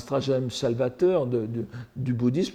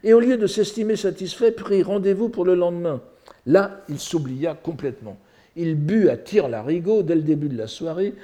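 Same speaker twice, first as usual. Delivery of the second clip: higher, expressive storyteller's delivery; declaimed narration with lively quotes; animated story reading; 6 semitones higher, 4 dB louder than usual. Acted. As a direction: read the comic tale with relish. Reads translated story aloud.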